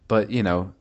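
ordinary talk, nothing marked

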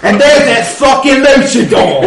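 loud, high mocking orc cry; orc shouting. Orc guard shouting at the hidden party, loud and high. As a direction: yell it out like an orc spotting prey. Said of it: "the orc's like".

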